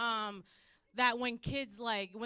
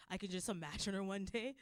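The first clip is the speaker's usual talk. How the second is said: ordinary delivery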